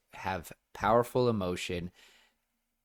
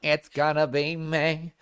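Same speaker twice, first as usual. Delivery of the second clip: mock meme line; 6 semitones higher, 5 dB louder, slower than usual. Meme reference, sung-ish.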